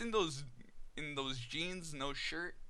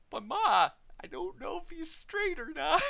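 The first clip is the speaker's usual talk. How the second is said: whiny protest; 4 dB louder than usual. Retold or imitated. Protesting to mom.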